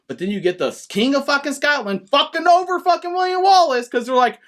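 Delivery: ordinary talk, nothing marked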